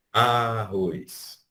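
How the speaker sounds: ordinary delivery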